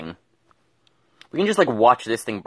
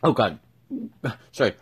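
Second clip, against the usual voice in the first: talking with a mouth full of food. Real, not acted. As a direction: speak through a mouthful of food. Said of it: "I had food in my mouth, tried to speak".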